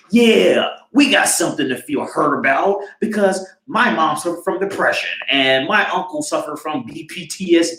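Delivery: high-pitched mock self-righteous claim; mock eager grievance. Voices eager majority claiming grievance.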